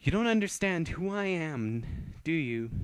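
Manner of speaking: mocking menace; menacing taunt. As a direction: lean into the threat. Angry vampire taunting slowly.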